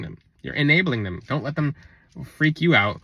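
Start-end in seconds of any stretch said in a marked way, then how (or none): none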